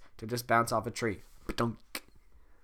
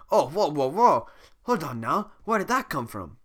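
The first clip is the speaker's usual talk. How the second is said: excited Donkey; 5 semitones higher, 7 dB louder than usual. Acted. Donkey voiced, amazed.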